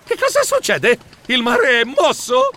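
high-pitched panicky questions; anxious. Acted. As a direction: ask in a fretful fright. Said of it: "domandai io in preda all'ansia".

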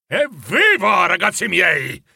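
high, hearty cheer; jolly exclamation. A narrator's reading. Old master cheering his apprentices, jolly exclamation. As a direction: cheer the lads on. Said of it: "esclamò il rubicondo Fezziwig".

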